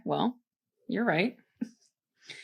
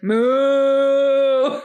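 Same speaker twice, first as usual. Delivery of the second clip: louder, higher mock cow; moo; 5 semitones higher, 6 dB louder than usual. Reading a cow comment, a loud mock moo. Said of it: "was like".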